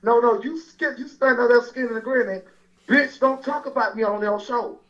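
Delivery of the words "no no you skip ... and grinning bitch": ordinary talk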